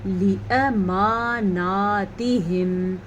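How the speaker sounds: لاماناتہم read very slowly, syllable by syllable; careful slow reading. Shown as a demonstration. Teacher slowing لاماناتہم down for students. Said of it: "غور سے پڑھ لیں".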